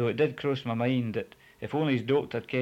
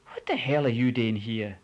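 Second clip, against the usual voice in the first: louder, startled question; louder dumbfounded demand; 5 dB louder than usual. A narrator's reading. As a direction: ask it in disbelief. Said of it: "I speered, dumbfounded".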